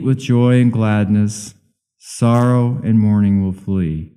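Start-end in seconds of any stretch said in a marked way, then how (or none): none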